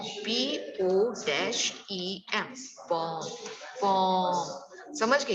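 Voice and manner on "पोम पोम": पोम stretched slowly, vowel held long; drawn-out pronunciation. Shown as a demonstration. Teacher modelling the word for students, पोम drawn out slowly. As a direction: repeat पोम, lengthening it the second time. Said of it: "क्या pronounce करेंगे बेटा".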